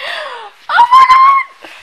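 high gasp of joy, nearly a cry; gasping oh my God. Real, not acted. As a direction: burst out overwhelmed with joy. Overjoyed about moving to Georgia.

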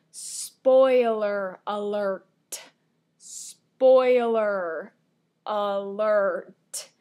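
very loud, drawn-out announcement; loud spoiler warning. Real, not acted. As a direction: boom out each word slowly to warn viewers. Warns viewers before revealing plot.

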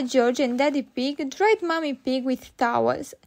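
plain reading voice

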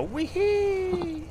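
very high playful wee-hee; gleeful whoop. Real, not acted. Bug bait works in game.

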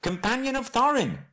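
very high, urgent call; crying out. Acted. Bilbo making himself known to the searching man, high cry. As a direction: cry out your name at the top of your pitch. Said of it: "he cried".

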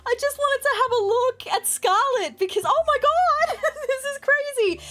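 high squealing through giggles; excited laughing squeal. Real, not acted. Scarlett pregnant, married; laughing.

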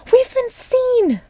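high, slow panicked squeak. A narrator's reading. Button spotting the dog racing toward them, a slow high squeak. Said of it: "Button squeaked".

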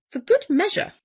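plain reading voice

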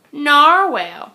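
ordinary delivery

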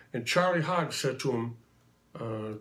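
ordinary talk, nothing marked

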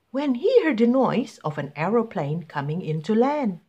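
ordinary delivery

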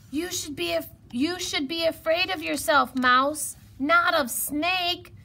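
exasperated high-pitched hedgehog; scolding hedgehog. A narrator's reading. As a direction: tell off a clumsy friend. Hedgehog scolds fallen Mouse.

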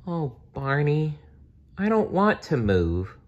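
put-on a little girl begging her toy; Lori crying. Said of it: "Lori cried".

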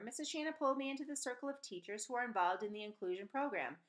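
ordinary delivery

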